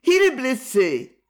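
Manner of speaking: put-on high, loud young woman's voice in alarm; alarmed cry. A narrator's reading. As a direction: cry out that he's hurt. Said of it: "avec un accent plein d'émotion".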